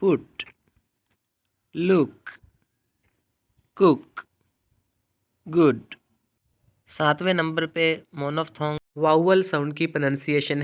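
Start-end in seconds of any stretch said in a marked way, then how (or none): 0.0-5.9 पुट, लुक, कुक, गुड with the short u of put; teacher demo of the put vowel; short oo words. Shown as a demonstration. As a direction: say गुड with a short u, a bit louder. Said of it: "For example".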